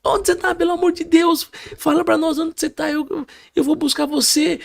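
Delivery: high-pitched, anguished pleading; pleading mother. Retold or imitated. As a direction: imitate a frantic mother begging her son. Acts out mother's desperate plea.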